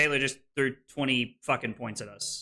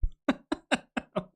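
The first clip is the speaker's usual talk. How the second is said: bitter laugh; 12 semitones higher than usual. Real, not acted.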